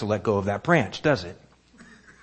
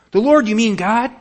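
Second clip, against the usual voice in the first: astonished question; 11 semitones higher, 8 dB louder than usual. Acted. Jack astonished at the voice.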